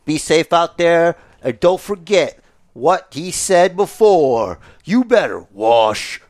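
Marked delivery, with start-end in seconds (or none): 4.8-6.2 gravelly catchphrase voice. Acted. Catchphrase in put-on voice.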